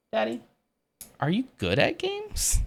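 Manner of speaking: put-on high child voice asking "games?" with a rising end; son's high voice. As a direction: imitate a little boy teasing his dad. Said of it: "Like that".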